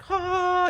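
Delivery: high sustained angelic "ah"; a high-pitched mock moment of awe; revelation "ah". Imitates his moment of revelation.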